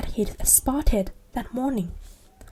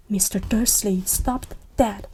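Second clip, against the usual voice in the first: usual speaking voice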